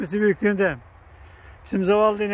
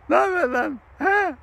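higher, teasing hello to a cow; playful greeting to animal; 7 semitones higher than usual. Real, not acted. Man greeting a cow up close, higher teasing hello. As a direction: tease the big animal with a friendly hi.

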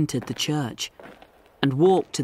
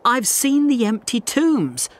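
insistent protest; 8 semitones higher than usual. Acted. Insisting to doubting doctor.

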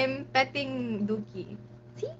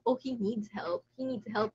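ordinary talk

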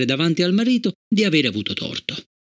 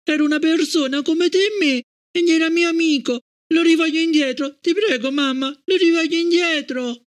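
put-on high, begging little girl's voice; pleading child; 13 semitones higher than usual. A narrator's reading. Gloria begging her mother, pleading child voice. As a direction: beg for her friend back.

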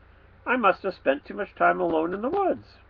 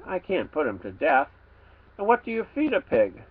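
plain reading voice

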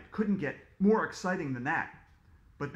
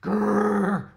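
loud growling bear; 3 semitones higher, 9 dB louder than usual. A narrator's reading. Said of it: "growled the bear".

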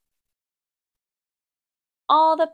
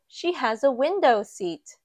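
usual speaking voice

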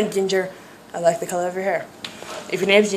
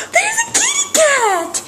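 excited squeal; 3 dB quieter than usual. Real, not acted. Excitedly spotting a cat.

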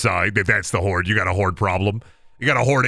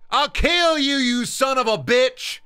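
high, furious vampire rant; berserk rage; 10 semitones higher than usual. Acted. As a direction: scream threats like a crazed vampire. Dracula rants at homeowner.

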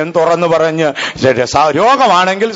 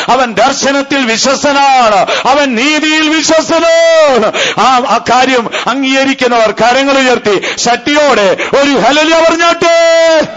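rising, higher preaching voice building to a call; fervent preaching; 6 semitones higher than usual. Acted. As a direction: preach it with growing fire. Preaching, calls for hallelujah. (ആവേശത്തോടെയുള്ള പ്രസംഗം)

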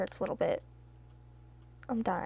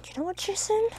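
"Jason" pitched higher, rising at the end; mock indignant; 8 semitones higher than usual.

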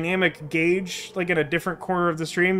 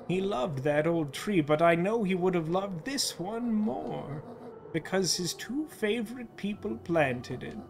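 soft, slow motherly voice; tender, reassuring mother; 8 dB quieter, slower than usual. Acted. Mother telling her child Dad lives on, soft and tender. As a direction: reassure softly, taking your time.

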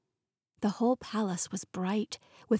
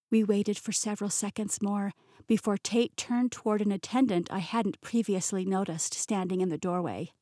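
ordinary delivery

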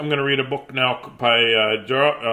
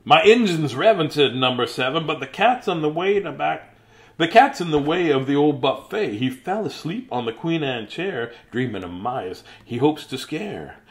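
put-on reading to children; 2 semitones higher, 3 dB louder than usual. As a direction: read it out big for the kids. Reading picture book to children.

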